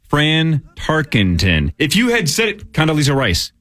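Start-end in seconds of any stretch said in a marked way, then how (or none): 0.1-1.7 name stretched out syllable by syllable; very slow, deliberate pronunciation; mock slow enunciation. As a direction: imitate an over-careful, labored reading. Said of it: "you're saying it so slowly".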